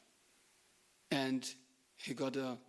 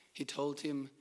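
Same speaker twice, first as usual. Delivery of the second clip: normal talking voice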